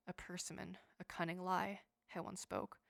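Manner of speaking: usual speaking voice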